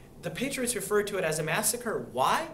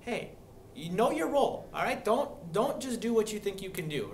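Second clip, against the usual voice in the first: a touch higher, mock scolding of the colonists; scolding British government; 5 semitones higher than usual. Teacher voicing the British government scolding colonists, mock stern. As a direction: playfully warn the colonists to know their role. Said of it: "This was kinda like".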